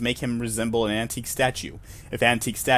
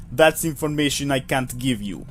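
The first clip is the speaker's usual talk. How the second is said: put-on Russian clerk voice; faux Russian accent. Clerk explaining procedure to visitor, faux Russian accent. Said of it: "a, faux Russian accent".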